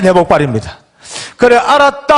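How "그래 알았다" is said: choked cry. Retold or imitated. Father answers son at execution.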